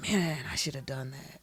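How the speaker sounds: whispered regretful thought. Pretend. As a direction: breathe it out unvoiced. Imagined regretful inner thought.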